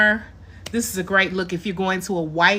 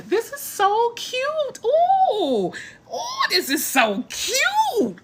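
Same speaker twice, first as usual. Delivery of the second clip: much higher, slow, laughing coo; delighted squeal; 14 semitones higher, slower than usual. Real, not acted. Admiring her new blonde look on camera, squealing with delight. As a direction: gush over it like a new favourite toy.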